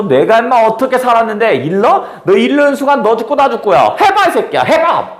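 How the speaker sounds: taunting, daring him, high and laughing; a brash laugh-edged challenge; the woman mocking the man who wants to turn her in; rough dare. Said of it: "거친 말 하면서".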